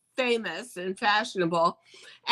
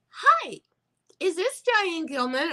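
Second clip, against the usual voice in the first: high, bright phone greeting from a stranger; chirpy caller; 9 semitones higher than usual. Retold or imitated. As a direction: chirp the hello like an unknown caller.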